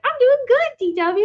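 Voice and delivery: lightly imitated cartoon friend, pitched high; a high, polite kid's reply, lightly voiced; Cynthia. Visiting friend answering cartoon kid, lightly imitated high voice. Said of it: "And she's like".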